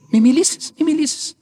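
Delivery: high-pitched imitation of the elder cutting in; urgent hushing. Elder silencing the grateful nun, urgent. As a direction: stop them quickly, voice jumping up.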